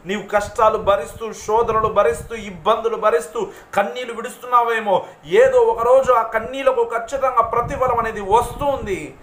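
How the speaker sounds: high, loud preacher's exhortation; impassioned preaching. Real, not acted. Preacher assuring suffering believers of reward, impassioned preaching.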